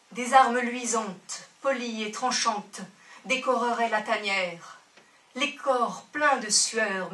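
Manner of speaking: rising dark declamation; impassioned recital. Acted. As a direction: declaim the grim images with force. Poem grows loud and intense.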